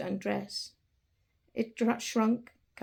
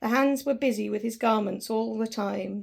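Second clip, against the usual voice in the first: usual speaking voice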